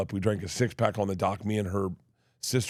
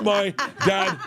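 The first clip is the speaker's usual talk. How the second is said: ordinary talk, nothing marked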